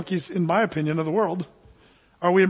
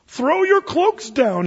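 cross; 9 semitones higher, 4 dB louder, slower than usual. A narrator's reading. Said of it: "he said crossly".

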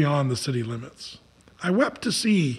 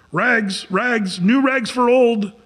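raised, higher peddler's cry; street vendor's call; 5 semitones higher, 4 dB louder, slower than usual. Acted. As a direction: call out your wares down the street. Ragman calling out his wares.

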